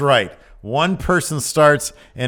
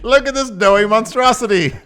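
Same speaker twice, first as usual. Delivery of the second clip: much higher, louder mocking showman; carnival barker; 11 semitones higher, 8 dB louder than usual. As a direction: sneer it like a sideshow host.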